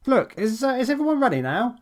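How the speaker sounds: high, fast, fraying patience; impatient director hurrying the crew; growing impatience. A narrator's reading. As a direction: push the question out, running out of patience. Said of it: "with growing impatience in his voice".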